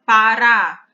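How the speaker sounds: Pará said loudly, strong final rá; stress on RÁ. Shown as a demonstration. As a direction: hit the rá of Pará hard for the class. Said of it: "também, o rá é pronunciado com mais força".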